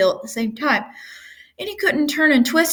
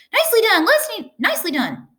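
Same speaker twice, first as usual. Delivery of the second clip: shouting goose; 13 semitones higher than usual. A narrator's reading. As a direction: squawk orders like a bossy goose. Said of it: "cried the goose".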